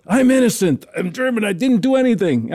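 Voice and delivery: lightly acted plea of innocence; German condemned man. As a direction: plead your case as a joke's condemned prisoner. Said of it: "German guy comes up".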